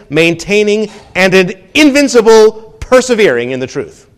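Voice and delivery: loud, high, preaching the quote with fire; fervent Luther. Retold or imitated. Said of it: "Luther replied".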